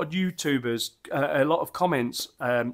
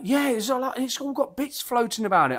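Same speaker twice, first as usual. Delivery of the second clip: put-on high, fretful old-dad voice, higher pitched; a mock-anxious old dad fussing over lumps; imitating worried dad; 9 semitones higher than usual. Dad frets to his son about bits in beer, high, mock-worried voice. Dad's reply retold.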